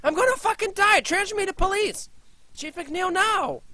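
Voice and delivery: high, loud panicked begging; desperate terror on the phone; frantic plea. Acted. Desperate caller begs operator.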